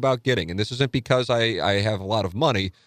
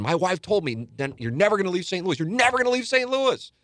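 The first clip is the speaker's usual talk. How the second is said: much higher, mocking the agent's fury; mock angry agent; 10 semitones higher than usual. Agent yelling he'll never leave Saint Louis, high mock fury. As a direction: squeak it out in outraged disbelief. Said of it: "don't blame the agent for being mad".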